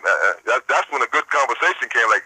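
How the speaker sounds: usual speaking voice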